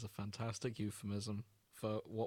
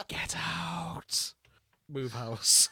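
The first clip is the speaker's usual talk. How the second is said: higher whispered ghostly get out; 5 semitones higher, 5 dB louder, much slower than usual. Retold or imitated. Joking about cursed ghost voices on the audio. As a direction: rasp it like a haunting ghost. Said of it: "ghosts going".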